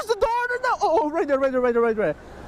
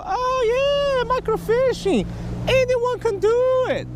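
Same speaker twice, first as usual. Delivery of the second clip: mocking quote; 10 semitones higher than usual. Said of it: "People say".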